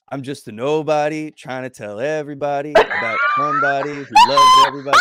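put-on shouted, high southern accent. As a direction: belt it like a loud southern preacher. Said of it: "I always say it with a southern accent".

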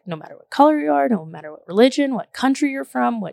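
lightly sing-song, quick read-aloud; reading a children's book. Acted. Reading the children's book rhyme aloud to viewers, sing-song. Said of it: "rhymes and stuff".

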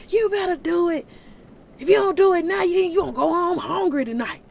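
loud, high, pushy goading; devil. Acted. Devil pushing someone to give in, loud and high. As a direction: raise pitch and volume to pressure them. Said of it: "The devil, like".

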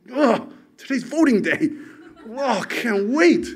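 high, excited sarcastic voice; mock eager citizen. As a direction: send up a gushing proud voter. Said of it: "Did you wake up yesterday".

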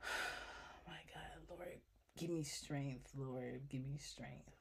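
soft whispered plea for strength; praying for patience through teeth. Real, not acted. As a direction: pray under your breath for patience.

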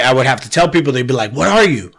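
normal talking voice

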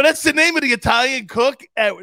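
loud, high, incredulous question; mock disbelief. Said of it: "I go".

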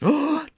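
mock gasp. Mock shock at abandoned quests.

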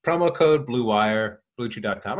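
ad read. Acted. Host to listeners, reading the promo code slowly.

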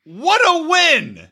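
high, slow sarcastic praise. Real, not acted. Co-host mockingly congratulating Michael, high sarcastic drawl.

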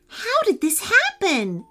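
high, astonished question; boy stunned by the snail's new dog legs; exclaiming. A narrator's reading. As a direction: blurt it out in disbelief. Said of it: "exclaimed Louis".

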